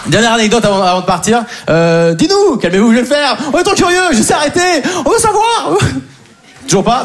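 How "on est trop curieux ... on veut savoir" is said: mock-eager crowd, climbing high; public impatient imité. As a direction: plead excitedly as the audience, rising in pitch. (faux public surexcité, de plus en plus aigu)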